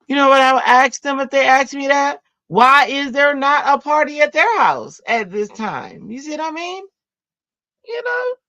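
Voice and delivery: high and loud sassy comeback; sassy retort. Acted. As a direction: throw it back at the neighbors with mock outrage. Mock indignant comeback.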